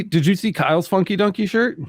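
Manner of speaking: ordinary talk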